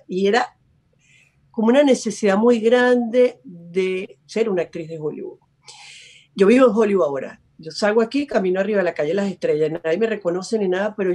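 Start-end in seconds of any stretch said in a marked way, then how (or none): none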